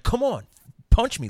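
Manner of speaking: high, loud taunting yell; retold challenge, shouted high; mock aggressive shout. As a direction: bark it like a drunk picking a fight. Aggressor picking a fight.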